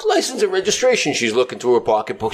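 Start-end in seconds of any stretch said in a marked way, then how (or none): 0.1-1.1 cop in the joke. Retold or imitated. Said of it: "Cop says".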